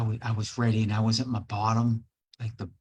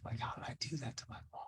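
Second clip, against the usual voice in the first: whispered regret; 15 dB quieter, faster than usual. Real, not acted. Regretful aside to himself.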